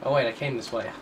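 ordinary delivery

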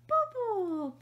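put-on high, loud toddler squeal of "bubble"; mother mimicking her toddler's delight at bubbles, high and loud; imitating excited toddler. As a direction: squeal it like a thrilled little boy spotting bubbles. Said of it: "he gets so excited".

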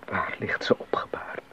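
soft whispered question; hushed whisper. Acted. Leopold asks where the dead wife lies, whispering. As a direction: whisper it in grief.

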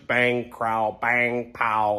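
each crunch a separate spoken sound effect; spoken comic-book sound effects; imitated crunching noises. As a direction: lightly mimic bones banging and popping. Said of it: "I can hear crunch".

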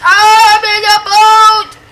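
high, loud and slow, stretching every word; slow drawn-out shout. Real, not acted. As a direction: belt it out long and slow, thrilled. Repeats line, second drawn out.

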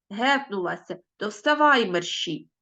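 lively read-aloud of a short story. Acted. Teacher reading a story aloud to pupils, lively.

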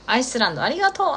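usual speaking voice

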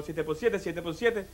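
low, soft working-out; great-grandfather pondering. Acted. Condemned ancestor puzzles over the second sum, low and hushed. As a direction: murmur the sum to yourself. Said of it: "dice mi tatarabuelo".

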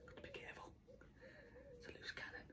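soft breathy whisper. Real, not acted. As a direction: whisper it so the dog won't hear. Whispering near the dog.

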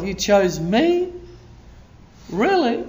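amazed disbelief. Acted. Preacher asks God about being chosen, amazed disbelief. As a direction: ask it as if hardly able to believe it.

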